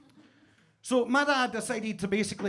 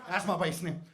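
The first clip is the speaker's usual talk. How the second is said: usual speaking voice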